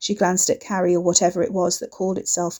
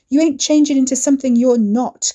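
forceful shout; 6 semitones higher, 8 dB louder than usual. A narrator's reading. Ricky shouting at her.

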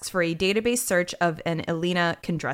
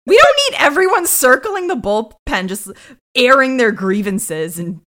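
exasperated rant; 7 semitones higher, 11 dB louder than usual. Pretend. Acting Olivia's exasperated rant.

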